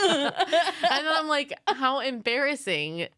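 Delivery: slow, amused with a laugh; laughing slowly. Retold or imitated. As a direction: drawl it out laughing. Retelling toilet story, laughing.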